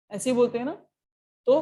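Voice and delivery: normal talking voice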